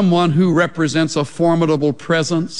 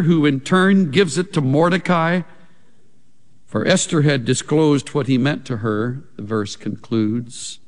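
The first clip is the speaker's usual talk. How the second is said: ordinary delivery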